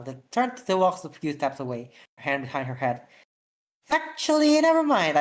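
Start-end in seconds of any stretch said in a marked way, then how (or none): 3.9-5.2 high playful voice; teasing girl. Acted. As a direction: tease him, pitched up high.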